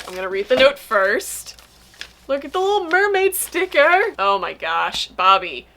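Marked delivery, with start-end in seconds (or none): 2.3-4.1 high-pitched delighted coo; real gushing delight, pitched high. Real, not acted. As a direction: coo over something adorable. Delighted at a mermaid sticker.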